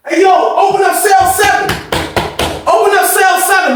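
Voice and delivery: high-pitched call down the cell block; imitated holler to the guard.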